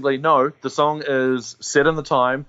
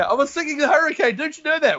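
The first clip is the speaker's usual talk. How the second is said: much higher, whiny-innocent protest; protesting excuse; 14 semitones higher than usual. Acted. Bluffer excusing himself over the song, high mock protest.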